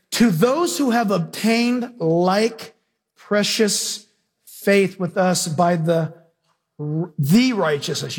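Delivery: slow reading, leaning hard on "the" before "righteousness"; stress on THE. Shown as a demonstration. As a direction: drag it out and hit "the righteousness". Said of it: "You should circle the word "the".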